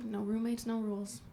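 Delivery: usual speaking voice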